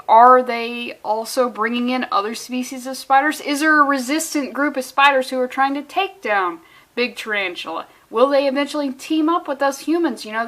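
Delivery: usual speaking voice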